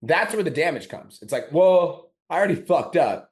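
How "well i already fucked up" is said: higher and louder, throwing hands up; shrugging it off like a quitter; mock defeatist voice. Mocking the self-sabotaging inner voice, higher and louder.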